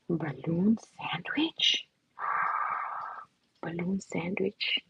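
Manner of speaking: slow, soft whisper of wonder; hushed delight; awed whisper. Real, not acted. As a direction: breathe it out in awe. Awed reading of prompt.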